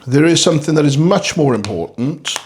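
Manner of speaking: normal talking voice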